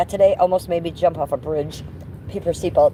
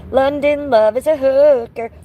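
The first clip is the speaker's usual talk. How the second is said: higher, louder, slow teasing chant; teasing sing-song; 8 semitones higher, 5 dB louder, slower than usual. Pretend. Streamer teasing viewer London, slow loud sing-song. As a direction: drag it out to tease London.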